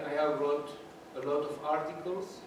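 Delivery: usual speaking voice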